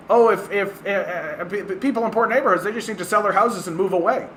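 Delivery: high, fast stammering mock voice; Ben Shapiro. Mocking a pundit's most famous stupid line, high and hurried. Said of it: "most famous stupid thing that he says".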